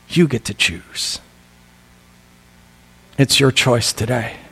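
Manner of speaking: low, soft, spaced-out preaching; solemn altar appeal. Real, not acted. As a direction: hand them the choice quietly, with long pauses. Solemn closing appeal to congregation.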